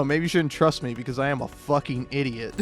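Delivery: normal talking voice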